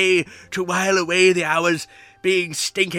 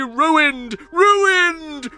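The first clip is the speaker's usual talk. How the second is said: building gloat; 6 semitones higher than usual. Acted. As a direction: gloat it up to a crescendo. Villain gloats.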